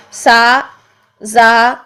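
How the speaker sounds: سا، زا with long straight aa; light open aa held in سا and زا; thin aa syllables. Shown as a demonstration. Teacher contrasting for students, سا and زا with thin aa. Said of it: "اس کے لیے آ".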